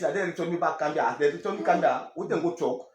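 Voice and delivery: ordinary delivery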